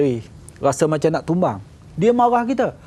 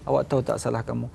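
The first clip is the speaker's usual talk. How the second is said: soft and quick, asking not accusing; a boss calmly questions a subordinate, softly; quiet calm reprimand; 5 semitones lower, 5 dB quieter, faster than usual. Retold or imitated. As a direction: gently ask if he knows his fault. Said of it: "kalau dia panggil ni".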